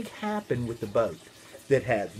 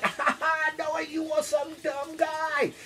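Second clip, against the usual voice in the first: very high, slow jeer through mock laughter; hammed-up taunting crowd; 11 semitones higher, slower than usual. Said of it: "they're laughing".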